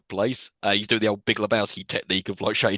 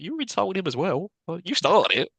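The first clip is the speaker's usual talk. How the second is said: mock indignant scolding; 8 semitones higher than usual. Scolding a film character.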